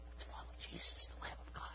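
whispered conspiratorially; hushed whisper. Retold or imitated. Two disciples whispering to each other, in a hushed whisper. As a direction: breathe it like a secret. Disciples quietly plotting to follow.

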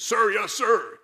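loud barked reply to sergeant; shouting. Pretend. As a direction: shout it like a recruit. Recruit answers drill sergeant.

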